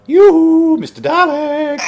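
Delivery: high, loud teasing call; yoo-hoo sung high and loud at the enemy; mocking sing-song taunt. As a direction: lure the monster out, playfully. Taunting the Dalek.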